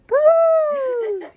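usual speaking voice

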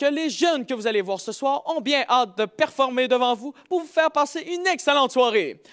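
ordinary talk, nothing marked

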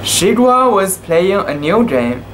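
plain reading voice

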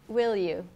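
"Will you" joined as "wil-yu"; linked sounds. Shown as a demonstration. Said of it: "người bản xứ thường nối âm như sau".

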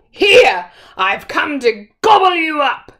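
loud, high troll bellow. Acted. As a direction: bellow it as you leap up.

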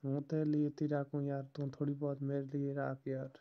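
usual speaking voice

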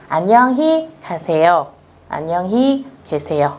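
slow, careful Korean farewells; slow model reading. Shown as a demonstration. Teacher reviewing both farewells slowly. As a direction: lay each syllable out for learners. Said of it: "câu cửa miệng người Hàn Quốc".

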